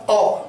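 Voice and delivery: "औ" as the open English vowel in "doctor"; isolated "औ", demonstrated as a borrowed English vowel. Shown as a demonstration. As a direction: say "औ" as one rounded, open o. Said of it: "थोड़ा सा ऐसे बना देगा".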